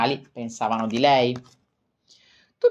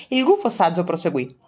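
ordinary delivery

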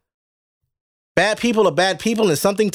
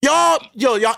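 accusing shout; 3 semitones higher, slower than usual. Real, not acted.

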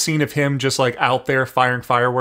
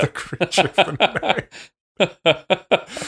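spooky announcer voice; 5 semitones higher, much slower than usual. Acted. Spooky echo of a joke.

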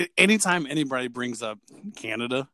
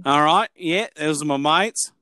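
mock Australian, wavering toward New Zealand; amateur Australian accent, played for laughs. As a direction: do a casual Aussie mate voice. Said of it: "it weaves a little bit in between Australia".

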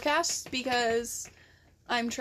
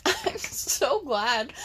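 usual speaking voice

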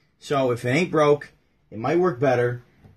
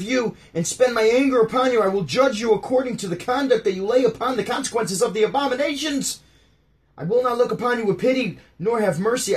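high, loud doom sermon; preacher proclaiming judgment; fire-and-brimstone preaching; 7 semitones higher, 4 dB louder than usual. Acted. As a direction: thunder it at the congregation. Reads Ezekiel as fiery sermon.

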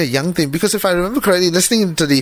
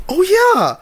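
excited realization; 12 semitones higher than usual. Retold or imitated. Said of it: "and it's like".

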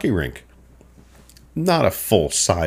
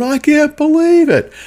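much higher, louder hooting disbelief; boys' disbelief; 6 dB louder than usual. Retold or imitated. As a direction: crow it like a laughing teenage boy. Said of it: "laughing their heads off".

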